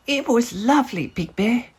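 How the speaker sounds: a small cub content at day's end; little bear voice. A narrator's reading. Little Bear's voice in story.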